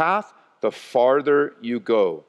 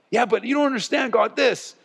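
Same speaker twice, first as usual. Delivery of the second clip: whiny protest; 8 semitones higher than usual. Retold or imitated. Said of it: "arguing with God".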